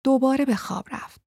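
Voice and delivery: ordinary delivery, nothing marked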